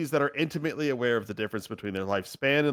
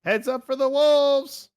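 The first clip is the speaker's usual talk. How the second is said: high-pitched drawn-out warning yell; 7 dB louder, much slower than usual. Acted. Wobbles flying over the adventurers, yelling a warning. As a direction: call out loudly over their heads. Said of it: "at a buzzing distance and yells".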